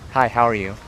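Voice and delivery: ordinary talk, nothing marked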